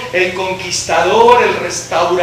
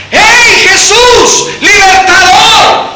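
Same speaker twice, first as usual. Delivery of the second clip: slow, loud, rising call; 9 semitones higher, 8 dB louder, much slower than usual. Acted. Preacher mocking a plain call to Jesus, loud. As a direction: call him by his title. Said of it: "le dijo".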